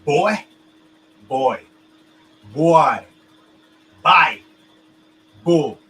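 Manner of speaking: put-on "bull" with a short oo sound, not "boy". Shown as a demonstration. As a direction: turn "boy" into a short "bull" like Philly speakers. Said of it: "they literally says it as if it's B U L L".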